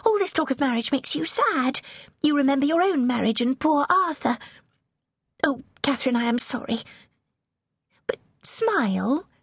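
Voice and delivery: put-on high, eager girlish voice; young princess rushing on. A narrator's reading. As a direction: gush on with mounting excitement. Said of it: "Mary rushed on".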